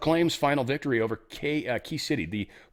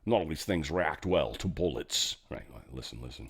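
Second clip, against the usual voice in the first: a lower, softer Scottish Connery read; gentle mock Scottish accent; Sean Connery impression; 8 semitones lower, 6 dB quieter than usual. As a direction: give the line a hint of Connery's Scottish accent. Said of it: "Sean Connery, I love you".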